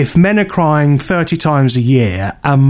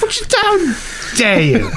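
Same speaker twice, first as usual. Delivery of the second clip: mock outrage. Real, not acted.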